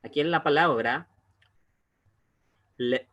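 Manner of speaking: normal talking voice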